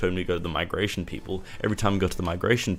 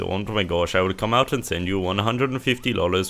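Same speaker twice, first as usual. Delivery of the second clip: hammed-up Indian call-centre accent, louder; mock Indian accent; 3 dB louder than usual. Provider's phone agent asking money to fix the internet, mock Indian accent. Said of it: "I'm sorry for the terrible accent".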